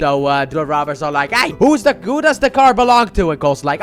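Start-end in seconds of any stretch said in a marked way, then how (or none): 1.3-3.3 mock robber. Robbers questioning gas station customers, mock demanding. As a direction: call out like a hammed-up stick-up man.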